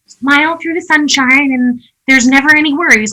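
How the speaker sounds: high, bright mock-cheerful voice; sing-song fake positivity; mock Pollyanna. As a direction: parody a relentless optimist. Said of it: "being a Pollyanna about it".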